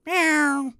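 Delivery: high cat meow; imitated meow. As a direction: call out like a stall cat. Cat at food stall.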